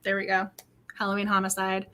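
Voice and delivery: ordinary talk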